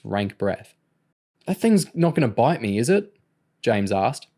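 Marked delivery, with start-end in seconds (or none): 1.4-3.1 high, worried, rising at the end; nervous question. A narrator's reading. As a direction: ask anxiously for reassurance. Boy fears the growling dog.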